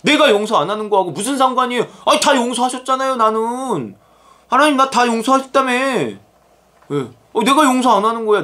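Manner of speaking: high, defiant mock complaint; talking back. Believer objecting to God over forgiving, talking back. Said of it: "하나님께 이렇게 대드는 판이에요".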